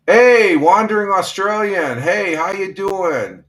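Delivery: light up and call out the greeting; excited greeting. Real, not acted. Radio host happily hailing a listener from Australia, excited.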